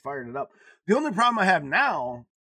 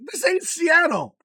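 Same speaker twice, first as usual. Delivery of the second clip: mock exasperated retort; 12 semitones higher, 8 dB louder than usual. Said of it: "I'm like".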